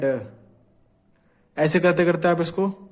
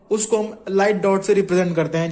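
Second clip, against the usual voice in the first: usual speaking voice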